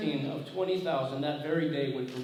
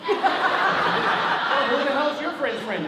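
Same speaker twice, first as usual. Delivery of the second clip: high, loud comic outburst; mock exasperation; 11 semitones higher, 9 dB louder, much slower than usual. Confused man demanding a name from the caller, comically exasperated. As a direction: blurt it out in hammed-up exasperation. Acted exasperated phone reply.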